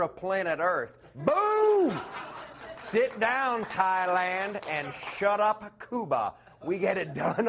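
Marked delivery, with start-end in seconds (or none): 1.1-2.0 high, loud boom; cocky yelled boom; triumphant shout. Acted. Triumphant brag. 2.7-6.3 higher, slow taunting; mock-arrogant put-down; cocky taunt. Taunting other countries.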